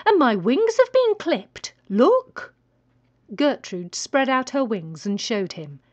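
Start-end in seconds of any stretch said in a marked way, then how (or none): 0.1-2.5 higher, louder indignant hen; indignant protest. Acted. Chicken protesting, showing wings.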